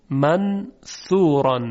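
منثورا slowed, with the نون hidden before ث; slow nasal منثورا. Shown as a demonstration. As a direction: drag منثورا with a nasal hum. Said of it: "اخفای نون قبل از سه".

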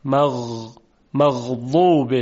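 slow مغضوب with smooth, soft غ; soft Arabic ghayn. Shown as a demonstration. Teacher demonstrating the correct smooth ghayn. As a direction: glide the غ of مغضوب softly, no stop. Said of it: "نرم و روان هست".

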